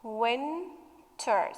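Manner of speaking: "Winter" softly split as win, ter; syllable by syllable. Shown as a demonstration. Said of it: "So it has two".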